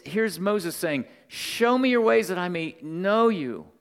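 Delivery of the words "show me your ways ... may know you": loud, high appeal to God. Acted. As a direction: cry it out as an acted prayer, raised and forceful. Acts Moses' plea, then repeats softly.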